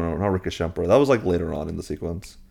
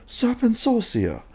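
much higher, slow mock incantation; casting a spell; slower than usual. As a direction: intone the spell high and slow like a showy rival. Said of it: "And then Malfoy goes".

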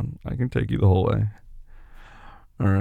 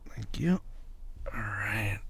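whispering; 9 dB quieter, much slower than usual. Acted. Hushed while carrying partner.

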